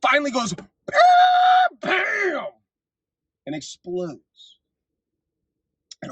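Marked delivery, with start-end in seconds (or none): 0.5-2.5 shouted high bam sound effect; mock explosion. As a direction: burst out with cartoon explosion noises. Imitating the economy exploding.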